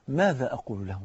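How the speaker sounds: low, soft, sober question; hushed motto. Shown as a demonstration. Preacher giving his brothers the motto, hushed and low.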